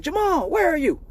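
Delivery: high calling-out mom voice; mock mom calling his name. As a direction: call down the hall like his mom.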